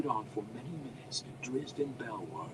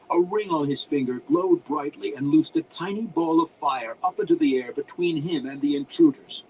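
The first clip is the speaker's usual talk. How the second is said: usual speaking voice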